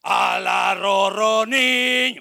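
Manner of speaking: mock-yell the baby song the wrong way; shouted lullaby. Shown as a demonstration. Preacher mocking a parent yelling a lullaby at the baby. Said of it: "no le cantaba".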